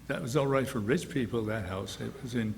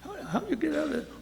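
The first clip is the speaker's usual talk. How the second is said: usual speaking voice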